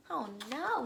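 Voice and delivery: ordinary delivery, nothing marked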